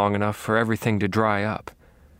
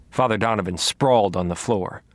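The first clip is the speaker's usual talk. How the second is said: usual speaking voice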